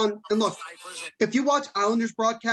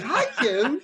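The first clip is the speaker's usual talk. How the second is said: imitated greeting, pitched up; voice going up; 4 semitones higher than usual. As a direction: swoop up on the friendly hello. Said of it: "went up like that".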